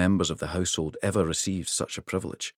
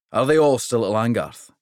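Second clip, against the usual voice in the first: a big king pleased with the trip; hearty king; 4 semitones higher, 6 dB louder than usual. Acted. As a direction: mountain of a king, hearty and louder. King's voice.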